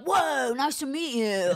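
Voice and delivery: high, slow, loud California stoner; blissed-out stoner greeting, hammed up; imitating Spicoli-like husband. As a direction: stretch it out like a surfer dude. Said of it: "that's how he talks".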